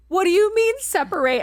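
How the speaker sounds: high, loud, incredulous what do you mean; panicked disbelief. Retold or imitated. Tourist baffled by the station agent, high panicked question. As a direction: blurt it out high and alarmed.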